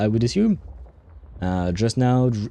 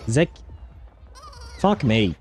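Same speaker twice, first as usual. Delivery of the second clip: normal talking voice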